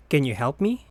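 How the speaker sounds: normal talking voice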